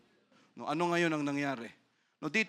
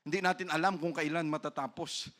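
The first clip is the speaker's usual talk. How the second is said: ordinary talk, nothing marked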